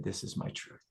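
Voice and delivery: whispered, low and intimate; whispered vow. Retold or imitated. Johann telling his coach his truth, whispered. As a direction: breathe it like a private oath.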